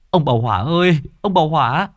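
high, loud frantic call. Acted. Villager calling Mr Hỏa urgently, loud frantic call. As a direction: yell to Mr Hỏa across the yard. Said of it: "Người kia gọi to".

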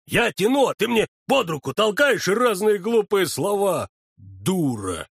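annoyed scolding medic; irritated bossing about; angry feldsher. A narrator's reading. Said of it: "сердится фельдшер". (сердито)

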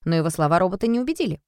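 ordinary delivery, nothing marked

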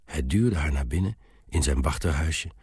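plain reading voice

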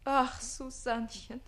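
slow, pining lament; lovesick sigh. Acted. Page to Susanne, slow lovesick lament. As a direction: sigh it out, heartbroken.

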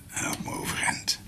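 zwak, fluisterend op het sterfbed; dying whisper. Acted. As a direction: fluister als een stervende man. Dying doctor whispers to Martine. (stervend gefluisterd)

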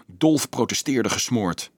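ordinary delivery

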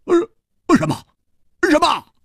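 a high, sudden what; stunned high outcry; shocked disbelief. Acted. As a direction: blurt it out in shock. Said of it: "失声说".

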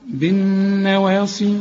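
"بالنواصي" slow, the doubled nun hummed long; chanted tajweed "بالنواصي" with held nun; nasal ghunna. Shown as a demonstration. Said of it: "لازم تغني النون".

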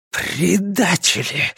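slow, hissed accusation; drawn-out, venomous hiss. A narrator's reading. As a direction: hiss it out with contempt. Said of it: "прошипел Гийом".

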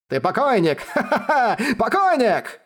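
high, cackling mad laughter; maniacal laughter. Acted. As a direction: laugh like a madman. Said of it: "Безумно хохотал Хуянь Цин".

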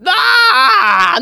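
sudden high yelp of pain; hammed-up shriek of pain; pained cry. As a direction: cry out as she grabs you. Coach attacked by gymnast.

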